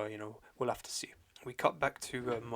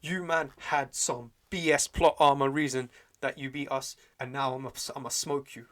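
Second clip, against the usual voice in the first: loud, emphatic mock taunt; trash-talking challenge; 3 semitones higher, 7 dB louder than usual. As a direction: call out an enemy, hammering the words. Acted trash-talking challenge to an enemy.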